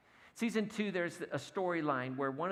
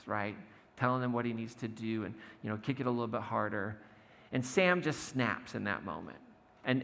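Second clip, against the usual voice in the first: usual speaking voice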